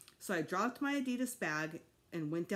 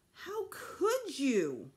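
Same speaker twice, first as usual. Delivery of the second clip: higher, louder, slow angry cry; boy shouting at his parents; shouted; 7 semitones higher, 4 dB louder, much slower than usual. A narrator's reading. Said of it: "I shouted".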